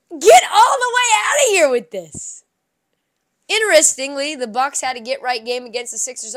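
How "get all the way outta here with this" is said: "Get all the way outta here with this" shouted higher and louder, slamming get; outraged shout, louder and higher; shouted dismissal. Real, not acted. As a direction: yell it at full force this time. Same line, escalated.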